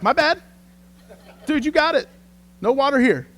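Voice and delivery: high-pitched comic voice for the wind; mock-obliging high voice; apologetic wind.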